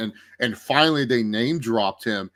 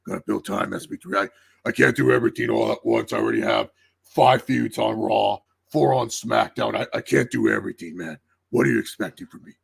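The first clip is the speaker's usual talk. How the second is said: lightly voiced overworked boss, a little lower; put-upon booker; 3 semitones lower than usual. Host voicing the booker who can't do everything, put-upon. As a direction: imitate a harried boss making excuses.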